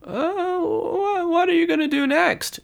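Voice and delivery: high, anxious monk's voice; fretting monk calling up to a friend on the rafters; jittery high call; nervous. Acted. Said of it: "calls Brother Michael nervously".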